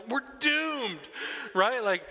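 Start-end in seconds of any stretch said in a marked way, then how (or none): 0.1-1.1 mock doom. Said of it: "living like".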